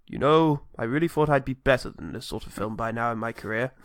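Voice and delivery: hint of a world-weary Dooku; mock Count Dooku. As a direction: sigh it like a tired old actor. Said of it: "Count Dooku confronts Obi-Wan and is like".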